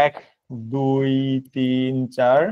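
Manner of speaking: measured note-taking pace, pausing between phrases; slow dictation. Shown as a demonstration. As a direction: dictate slowly for students writing it down. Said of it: "লিখে নেবেন".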